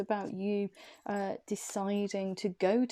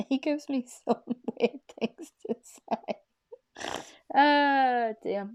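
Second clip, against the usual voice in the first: laughing through the words, higher and louder; 6 semitones higher, 6 dB louder, slower than usual. Real, not acted. Telling viewers about the Italian guide, laughing loudly. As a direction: giggle while explaining. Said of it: "that's the reason why I'm laughing".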